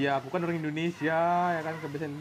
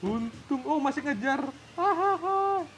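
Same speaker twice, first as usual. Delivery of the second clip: a high, loud yelp that turns into laughter; surprised laugh; 10 semitones higher, 6 dB louder than usual. Real, not acted. Gamer sees the yakuza still chasing, high surprised laugh. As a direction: caught off guard, then crack up.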